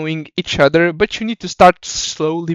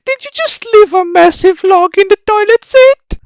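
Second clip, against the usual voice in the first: put-on very high-pitched squeaky girlfriend voice; mocking falsetto girlfriend; 3 dB louder than usual. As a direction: play the exasperated girlfriend, voice way up. Skit: girlfriend voiced by host.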